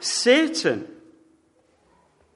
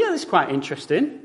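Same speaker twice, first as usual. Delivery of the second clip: mock unenthusiastic; 4 semitones lower, faster than usual. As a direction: toss it off with polite indifference. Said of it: "tell the difference as soon as we open our mouths".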